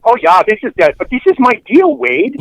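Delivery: put-on high, lilting Fargo accent; exaggerated Fargo-style Midwestern accent. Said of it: "that stupid Midwestern accent".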